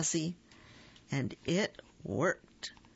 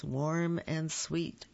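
ordinary delivery, nothing marked

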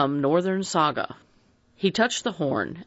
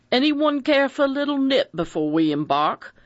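hospitable bluff man; 9 semitones higher than usual. A narrator's reading. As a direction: invite them warmly like a jolly host. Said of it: "asked Mister Davis hospitably".

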